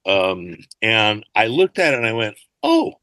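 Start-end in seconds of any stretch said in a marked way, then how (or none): 2.6-2.9 high-pitched mock "oh"; retold surprise. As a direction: let a little squeak of realization out. Retelling his reaction to the script.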